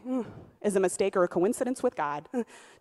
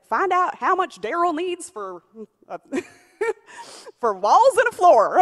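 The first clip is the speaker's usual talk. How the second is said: higher, slower mock pastor voice, less twangy; imitating the pastor; 10 semitones higher, 9 dB louder than usual. Quoting pastor's Sunday night call in his voice, higher and slower. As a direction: drawl it out the way pastor would. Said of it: "I'm a little bit more twangy than you".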